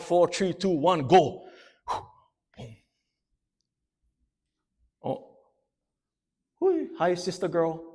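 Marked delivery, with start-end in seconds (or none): none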